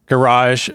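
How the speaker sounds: garage stressed on the second syllable; American garage. Shown as a demonstration. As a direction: say garage as guh-RAHZH. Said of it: "with my American accent".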